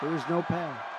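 a quiet, disbelieving read of the verdict; stunned hushed announcement. Retold or imitated. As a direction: let the words drop out in mock shock. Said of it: "And he goes".

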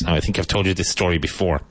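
usual speaking voice

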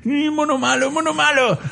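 high-pitched mock reproach; scolding the monkey. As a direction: wag a finger at the ape in a squeaky voice.

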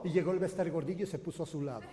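ordinary talk, nothing marked

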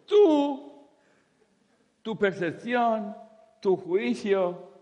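high, loud, slowing to the end; emphatic retort. Retold or imitated. Speaker answers the lady, loud and slowing.

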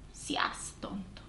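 a secret whispered aside to friends. Retold or imitated. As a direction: whisper it so the couple won't hear. (susurrando en secreto a las amigas)

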